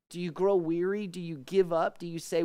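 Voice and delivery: ordinary delivery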